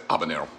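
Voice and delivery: habanero said ah-ba-NEH-ro; silent H. Shown as a demonstration. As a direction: habanero with no H sound. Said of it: "not pronounce the H".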